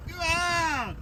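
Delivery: high sharp call to the cows. Retold or imitated. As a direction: shout Go on at the herd. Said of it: "my face and said".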